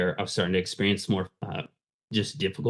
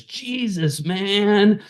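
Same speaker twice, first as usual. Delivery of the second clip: much higher, stretched-out stoner voice; hippie stoner; 12 semitones higher, 3 dB louder, much slower than usual. Retold or imitated. Hippie stoner friend answers another kid's question, high stoner drawl. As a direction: imitate a spaced-out stoner, pitched way up. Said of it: "sort of hippie stoner".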